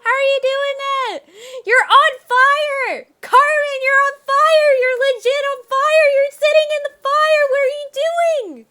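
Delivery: high, loud, frantic screaming; panicked yelling. Real, not acted. Player shrieking at Carmen sitting in the fire, panicked. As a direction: scream at her to get out.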